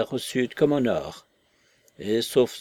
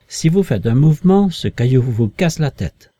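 loud, forceful threat; menace impérieuse; 3 semitones higher, 9 dB louder than usual. A narrator's reading. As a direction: warn them off with full force. La voix menace Marvel d'une pierre. (menace lancée d'une voix forte)

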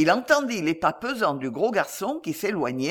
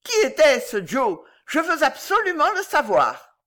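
high, raised urgent voice; insistent demand; 9 semitones higher, 4 dB louder than usual. A narrator's reading. Old lady demanding the culprit's name, insistent. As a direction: insist on knowing, pitched well up.

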